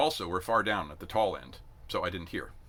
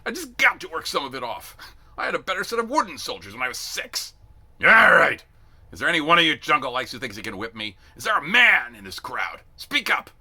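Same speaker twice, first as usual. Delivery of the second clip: loud, high taunting bellow; challenging shout; 7 semitones higher, 10 dB louder than usual. A narrator's reading. Sergeant dares any recruit to fight him, loud taunt. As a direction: dare them at the top of his voice.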